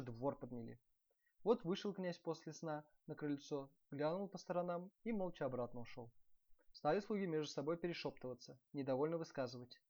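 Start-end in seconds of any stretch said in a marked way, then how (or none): none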